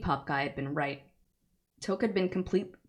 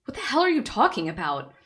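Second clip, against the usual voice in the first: loud, higher, furious question; angry demand; 8 semitones higher, 7 dB louder than usual. Acted. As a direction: shake the answer out of him. Grabs him, demanding answers.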